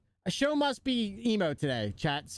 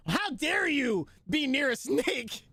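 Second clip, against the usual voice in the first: mock scolding, higher and slow; imitating sister-in-law; 8 semitones higher, slower than usual. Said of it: "was like".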